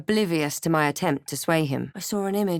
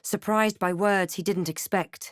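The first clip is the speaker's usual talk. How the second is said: ordinary talk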